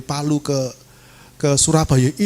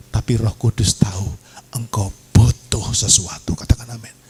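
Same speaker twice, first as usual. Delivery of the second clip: ordinary talk, nothing marked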